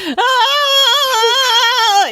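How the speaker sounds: high loud mock scream of panic. Wife teasing husband about his reaction to the text, mock panicked scream. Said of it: "And you were like".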